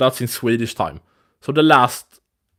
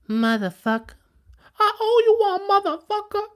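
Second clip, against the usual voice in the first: mock squeaky voice. Mock thanks after fixing setup.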